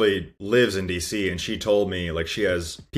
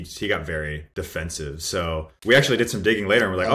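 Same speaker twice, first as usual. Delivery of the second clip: ordinary talk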